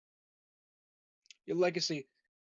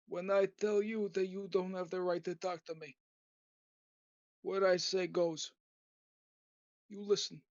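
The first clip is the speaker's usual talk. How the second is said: put-on old mob boss voice. Aging mob boss warning a would-be killer, hammed-up menace. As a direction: play an aging don threatening a visitor, half-joking. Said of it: "I'll do that voice for, like, a minute".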